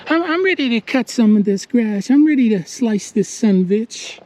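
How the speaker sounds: high-pitched voice for an eager machine; playful high character voice; talking mower. Retold or imitated. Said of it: "It's the twenty-six twenty screaming at me".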